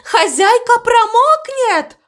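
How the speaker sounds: very high, drawn-out cry of alarm; urgent shout. A narrator's reading. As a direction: cry a warning at a high pitch. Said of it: "закричала мама Му". (отчаянный высокий крик)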